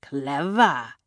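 put-on one sardonic word in a European accent; grudging, mocking European-accented villain; sarcastic. A narrator's reading. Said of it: "he said sarcastically".

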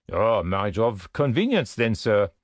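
ordinary delivery, nothing marked

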